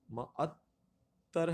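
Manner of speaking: معطر very slowly as ma-at-tar; pronunciation option. Shown as a demonstration. Teacher offers a choice, slow pronunciation. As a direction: open معطر with ma-, drawn out. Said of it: "یہ کیا یہ تلفظ".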